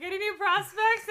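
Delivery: high, loud prospector voice; hammed-up gold miner question. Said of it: "And I was like".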